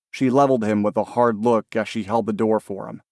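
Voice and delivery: ordinary delivery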